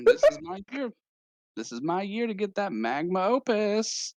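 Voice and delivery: put-on high-pitched Valley Girl declaration. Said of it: "kinda like a Valley Girl".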